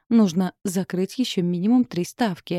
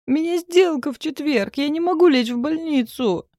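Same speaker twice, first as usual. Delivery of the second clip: panicky; 9 semitones higher, 4 dB louder than usual. A narrator's reading. Max panicking about his deal.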